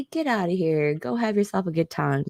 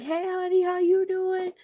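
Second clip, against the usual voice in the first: much higher, beaming greeting; cheery postal clerk; 12 semitones higher than usual. Retold or imitated. Friendly clerk greeting every customer, cheery high voice. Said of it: "he's like".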